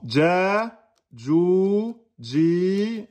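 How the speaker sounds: Ja, ju, ji, each vowel stretched two beats; long vowels two beats. Shown as a demonstration. Teacher drilling long vowels, two beats each. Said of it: "Là, deux temps".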